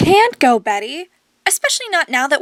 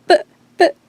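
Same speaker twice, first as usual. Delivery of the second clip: tearful and higher, voice breaking; crying; 3 semitones higher, 3 dB louder than usual. Acted. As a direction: choke out her name through tears. Grieving her mother's death.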